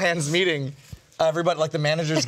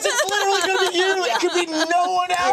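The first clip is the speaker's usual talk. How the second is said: much higher and fast, mock-exasperated; exasperated friend; 13 semitones higher than usual. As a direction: fire it off high in disbelief. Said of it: "It's like".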